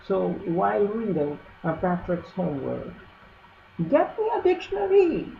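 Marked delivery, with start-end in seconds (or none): 3.9-5.3 high, fast, squeaky demand; thin reedy voice. Acted. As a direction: squeak it like a rat. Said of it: "he would squeak".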